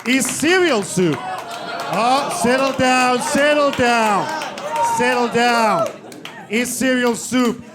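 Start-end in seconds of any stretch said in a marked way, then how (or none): 1.2-5.9 loud, higher settle down over the crowd noise; calming the crowd. Real, not acted. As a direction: hush the rowdy audience loudly.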